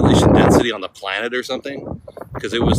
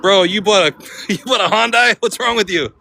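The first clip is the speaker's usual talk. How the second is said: high, loud mocking tease; mocking bro; 9 semitones higher, 3 dB louder than usual. Vlogger mimicking eighties kids teasing a Hyundai buyer, louder, higher. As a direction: rib a friend over his cheap car. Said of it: "you'd be like".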